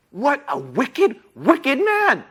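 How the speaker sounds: high, theatrically appalled; mock dismay. Storyteller to children after the villain's theft, mock dismay.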